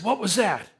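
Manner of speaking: high, loud and alarmed; startled question. Retold or imitated. Hearing a splash in the dark, startled. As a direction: jolt up at the sudden splash.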